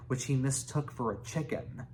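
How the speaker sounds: ordinary delivery, nothing marked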